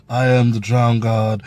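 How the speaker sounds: low, casual, like a normal conversation; mocking a weak delivery. Reviewer mimicking Euron's flat actor, low and offhand. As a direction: throw it away like small talk. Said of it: "He was just like a normal guy".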